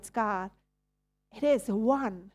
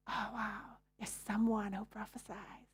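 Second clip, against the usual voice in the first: much softer, hushed mock wonder; mock awe; 11 dB quieter than usual. Preacher mimicking a dazzled believer, hushed mock awe. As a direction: play the dazzled follower, softly.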